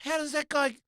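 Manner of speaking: imitating shocked family afterwards; family disbelief. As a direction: marvel at the rude kid. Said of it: "we'd go".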